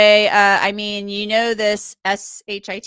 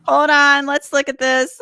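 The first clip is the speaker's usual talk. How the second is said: ordinary delivery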